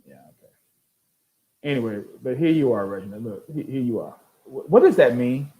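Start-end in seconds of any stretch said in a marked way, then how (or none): none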